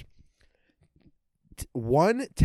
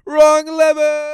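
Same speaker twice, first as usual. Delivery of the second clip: high, slow, loud cartoon villain; villain shriek; 12 dB louder, much slower than usual. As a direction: shriek it at the henchman. Acted Yzma quote.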